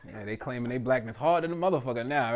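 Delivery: ordinary talk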